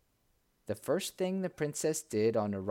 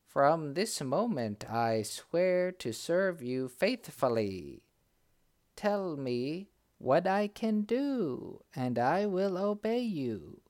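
slow, high, solemn magic bird; talking bird voice; 6 semitones higher, slower than usual. A narrator's reading. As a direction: bird vowing loyalty, slowly. Talking bird swears service.

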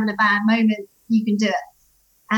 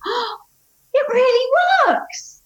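put-on amazed child; 13 semitones higher, slower than usual. Charlie voiced amazed.

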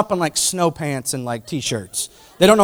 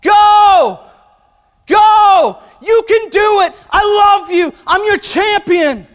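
much higher, louder cheering shout; screaming; 9 dB louder, much slower than usual. Retold or imitated. God voiced as a father cheering his child on, shouting.

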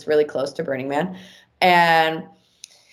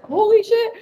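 very high, loud squeal; high-pitched astonished exclamation; retold amazement; 5 dB louder, slower than usual. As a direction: act out an awed outburst.

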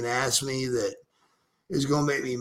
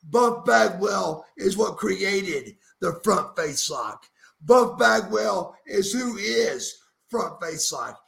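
wrestling promo; 3 semitones higher than usual. Acted. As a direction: hype yourself up like a pro wrestler. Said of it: "Cut me a Front Face Lock podcast promo as Buff Bagwell".

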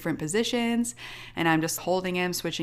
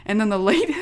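normal talking voice

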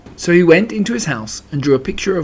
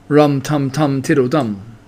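ordinary delivery, nothing marked

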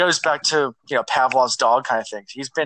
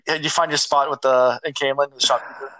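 normal talking voice

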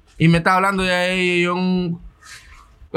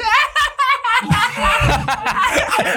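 squealing laugh, much higher than his voice; high-pitched burst of laughter. Real, not acted. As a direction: break into a high helpless laugh. Laughing at his own story.

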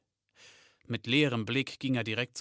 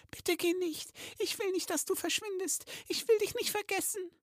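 pleading in a very high squeaky voice; fearful elf girl. Acted. Kistel begging her friend not to go, fearful, very high. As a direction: beg fearfully in a tiny high voice. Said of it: "Angsterfüllt hielt Kistel ihn fest".

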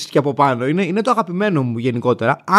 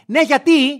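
high, louder indignant "yes, why?"; woman's snippy reply; 10 semitones higher, 4 dB louder, slower than usual. Retold or imitated. Blocking driver snaps back at him, high and indignant. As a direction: play the defensive driver caught out.

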